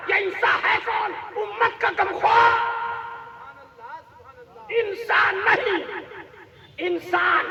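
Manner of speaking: rhythmic, ringing question-and-answer chant; impassioned preaching refrain. Real, not acted. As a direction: hammer each question, then proclaim the title. (जोशीले सवाल-जवाब वाली लयदार तक़रीर)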